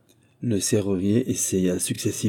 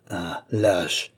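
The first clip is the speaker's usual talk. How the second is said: through gritted teeth. A narrator's reading. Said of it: "dit-elle en grinçant des dents".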